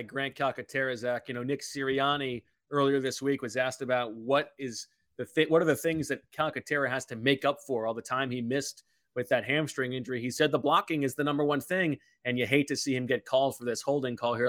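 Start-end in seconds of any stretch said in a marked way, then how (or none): none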